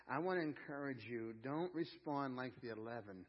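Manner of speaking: ordinary talk, nothing marked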